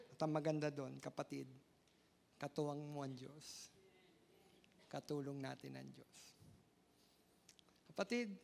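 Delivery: soft pastoral comfort. Acted. Pastor comforting troubled listeners, soft and low. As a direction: ease down and console the listener gently.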